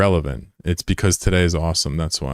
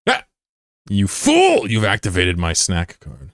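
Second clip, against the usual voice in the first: hammed-up villain; slower than usual. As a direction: gloat like a cartoon villain. Gloating like a cartoon villain.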